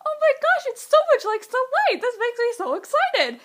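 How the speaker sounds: high, loud, gushing fan squeal; excited squeal. Retold or imitated. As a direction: shriek with delight at the twist. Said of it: "I was just like".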